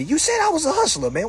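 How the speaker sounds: ordinary delivery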